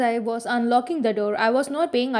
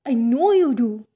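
louder, sharp retort; snapped; 5 dB louder than usual. A narrator's reading. Bella throwing the accusation back at Edward, snapping loudly. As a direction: bite the words off at him. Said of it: "I snapped".

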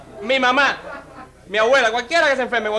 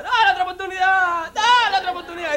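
crowd yelling; 8 semitones higher than usual. Acted. Whole family begs the executioner yet another time, yelling high. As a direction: beg as a whole family crying out. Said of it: "dice la familia entera".

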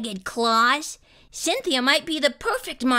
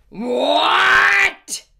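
long, loud roar of disbelief; outraged monster roar; 5 semitones higher, 7 dB louder than usual. A narrator's reading. Monster hearing Emma isn't afraid, outraged roar. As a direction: roar the word out.

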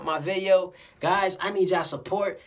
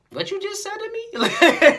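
very high, loud, drawn-out incredulity; mock disbelief; 8 dB louder, slower than usual. Stunned person answering a blessing, exaggerated disbelief. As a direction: imitate someone who can't believe their ears.

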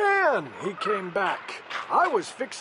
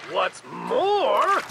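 snapped; 8 semitones higher, 3 dB louder than usual. Acted. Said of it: "snapped the Lorax".

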